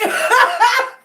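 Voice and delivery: high burst of laughter. Real, not acted. Reacting to the begging.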